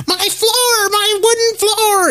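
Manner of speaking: very high squealing "my floor"; wife's shriek. Retold or imitated. Mimicking wife wailing about her wooden floor, very high. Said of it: "That's exactly the way she sounds".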